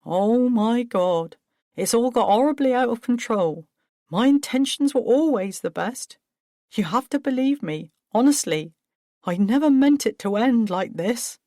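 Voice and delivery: Paul, overwhelmed and desperate; anguished. Acted. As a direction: pour out remorse. Paul breaks down.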